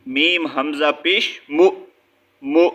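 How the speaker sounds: مو cut off with a glottal catch; jerk on hamza. Shown as a demonstration. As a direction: spell it out, then stop مو with a jolt. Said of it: "جھٹکا دینا ہے". (مو پر جھٹکا دے کر)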